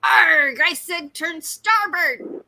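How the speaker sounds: slow, loud growling pirate. Acted. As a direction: bark it like a pirate captain. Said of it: "I want a pirate voice for Google Maps".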